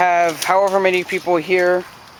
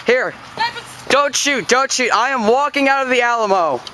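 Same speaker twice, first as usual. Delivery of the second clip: panicked surrender shout; 7 semitones higher than usual. Real, not acted. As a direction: yell so they hold fire. Surrendering while walking out.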